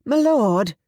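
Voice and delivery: high, loud call for attention; loud urgent call. Acted. Susan getting Thomas's attention, loud. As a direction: make him look up. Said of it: "Susan spoke loudly".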